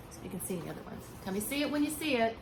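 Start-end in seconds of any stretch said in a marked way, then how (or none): none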